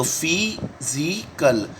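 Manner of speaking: Physical stretched out very slowly; very slow drawn-out word. Shown as a demonstration. Teacher writing on the board, very slow physical. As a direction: linger on every sound of physical. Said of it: "teacher draws the word out".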